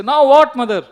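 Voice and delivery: very loud, high exasperated question; playful impatient outburst; mock exasperation. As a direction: act out a son fed up with being called. Son called back yet again.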